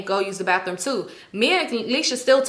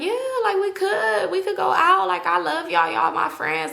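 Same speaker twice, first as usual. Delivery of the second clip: higher gushing hanger-on voice; imitating Clingy; 8 semitones higher than usual. Clingy gushing about going out, higher needy voice. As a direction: gush like a needy new friend.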